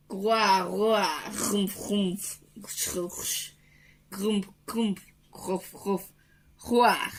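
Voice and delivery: Chewbacca-like roars and grunts; creature growls. Acted. Would-be Chewbacca in a bad mood, growls and grunts. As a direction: grumble and roar like a grumpy beast. Chewbacca-like grumbling, after the film talk.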